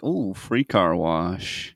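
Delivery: slow, drawn-out mock delight; imitated local talk. Joking about rain as a car wash, slow drawl. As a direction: stretch every word out, quietly. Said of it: "the way people talk".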